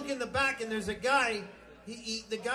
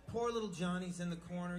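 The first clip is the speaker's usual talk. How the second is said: ordinary talk, nothing marked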